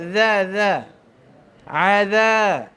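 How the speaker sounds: Za with the tongue out between the teeth; tongue-out zal. Shown as a demonstration. Teacher demonstrates zal with the tongue out. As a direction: push the tongue out on za. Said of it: "Keluar lidah pada mulut".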